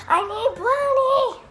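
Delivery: high, loud, slow whine; whiny demand. Pretend. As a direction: drag it out like a needy kitten. Whining for a brownie.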